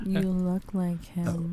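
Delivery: slow, hushed whisper; teasingly slow, very soft whisper. Pretend. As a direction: whisper it slowly and suggestively on request. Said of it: "I whispered".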